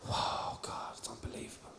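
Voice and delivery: breath-only awed whisper; stunned whisper. Retold or imitated. Comedian answering a tearful woman's story, stunned whisper. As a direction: whisper it, as if floored by what you heard.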